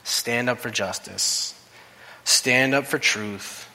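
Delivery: slow, measured command; slow inner voice. Acted. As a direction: deliver each word slowly, like a voice within. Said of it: "I could hear this voice saying within me".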